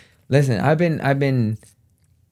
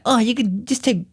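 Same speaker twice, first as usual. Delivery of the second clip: higher mock voice for her suggestion; 8 semitones higher than usual. Mocking her gym suggestion in a higher voice. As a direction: parody her breezy advice. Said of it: "And she be like".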